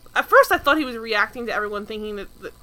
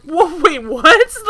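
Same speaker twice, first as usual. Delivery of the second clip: high, loud, baffled exclamation; stunned disbelief; 5 semitones higher, 9 dB louder, slower than usual. Acted. Podcaster reacting to the abrupt turn to religion, stunned disbelief. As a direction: react loudly as if thrown by it. Mock reaction to sudden subject change.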